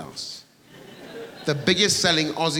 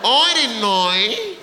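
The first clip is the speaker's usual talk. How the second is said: higher, louder, drawn-out plea of innocence; exasperated protest; 7 semitones higher, 3 dB louder than usual. Real, not acted. Comic protests to crowd he didn't know, high and loud. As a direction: stretch it out, pleading and laughing. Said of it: "protesting innocence".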